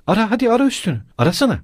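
high, insistent goading; challenging. A narrator's reading. Katerina daring Lujin to search her, challenging.

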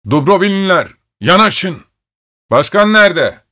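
a weighman shouting for the villagers; calling out. A narrator's reading. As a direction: yell to the carts from the gate. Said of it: "arabalara bakarak bağırdı".

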